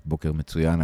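usual speaking voice

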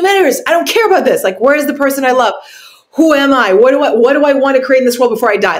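high, fast, pressing inner voice; urgent inner panic. Retold or imitated. Someone facing death crying out what matters. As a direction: blurt out what really matters, rising in alarm. Voicing panicked thoughts in crisis.

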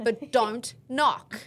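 slow warning. Retold or imitated. Stern warning to boyfriend.

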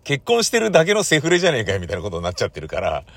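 usual speaking voice

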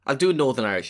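ordinary talk, nothing marked

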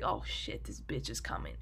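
dog seeing his owner come, whispered; dog's inner voice. Retold or imitated. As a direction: voice the dog muttering in alarm. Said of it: "he's like".

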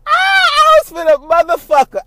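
high, loud, drawn-out laughing yell; burst out yelling. Real, not acted. To her viewers, a giddy loud yell at her own joke.

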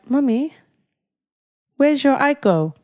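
a hint of a little boy's questioning voice; lightly voiced young son. Mother mimics son asking about the car, lightly voiced child. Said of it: "Ivan was like".

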